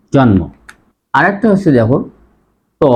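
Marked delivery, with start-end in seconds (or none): 0.1-0.4 জন্ম with a clear m; correct pronunciation. Shown as a demonstration. Teacher concludes the rule, m sounded in জন্ম. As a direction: say "jonmo" for জন্ম. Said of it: "সে কারণেই উচ্চারণ".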